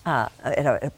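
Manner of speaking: ordinary talk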